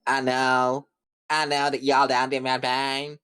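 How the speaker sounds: exaggerated Southern twang, mocking; mock Southern accent. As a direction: mimic an uncharming Southern accent. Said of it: "less charming, like, Southern accent".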